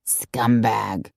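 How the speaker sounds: low, contemptuous growl. A narrator's reading. Disgusted friend judging the cheating man, low growl. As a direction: growl the insult deep down. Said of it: "Vonda growled".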